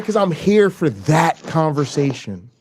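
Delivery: normal talking voice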